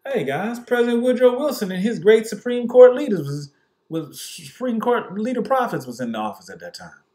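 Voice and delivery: mock pompous official. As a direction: announce it like a smug big shot. Mocking the powerful.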